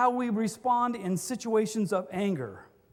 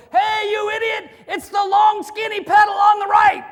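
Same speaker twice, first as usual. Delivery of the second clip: loud, much higher yelling at a stalled car; angry driver shout; 9 semitones higher, 12 dB louder than usual. Retold or imitated. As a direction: lean on it like a furious driver.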